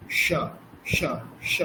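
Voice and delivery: স with tongue curled back, retroflex sh. Shown as a demonstration. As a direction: curl the tongue for মূর্ধন্য স. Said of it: "এরপরে মূর্ধ স উচ্চারণ করছি".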